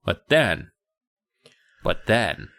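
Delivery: low, slow ominous lead-in; ominous build-up. Acted. Podcaster sets up the horror beat, low and ominous. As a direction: tease the scare.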